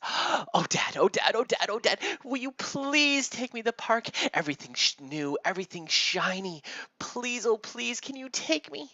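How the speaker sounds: gasp, then high, loud begging; an over-eager kid pestering Dad, high and loud; pleading excited child. Acted. Timmy turns to his father, begging excitedly.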